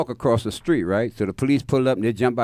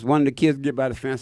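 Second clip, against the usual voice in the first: ordinary talk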